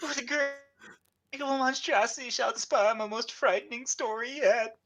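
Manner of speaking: high, slow horror-author voice; Lovecraft. Acted. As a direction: declaim in dread, as a fussy horror writer. Voices Lovecraft answering in the meme.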